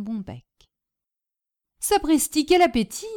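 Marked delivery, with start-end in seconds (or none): 1.8-3.1 mildly raised cry of astonishment; amazed exclamation. A narrator's reading. Hostess watching her guest wolf his food, amazed. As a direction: marvel at it out loud. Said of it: "s'écria-t-elle".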